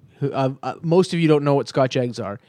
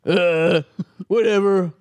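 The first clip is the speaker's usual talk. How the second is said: mock careless company; 3 semitones higher than usual. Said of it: "when they're like".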